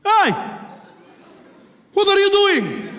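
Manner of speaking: put-on loud, high accented challenge; mock Middle Eastern outraged yell; indignant passenger. Passenger confronts the attacker.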